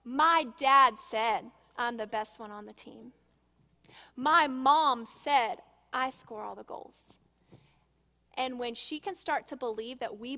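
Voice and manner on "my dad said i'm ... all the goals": louder, slow and deliberate, each phrase spaced. Shown as a demonstration. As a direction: say it slowly and firmly. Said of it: "This is what you're gonna tell him".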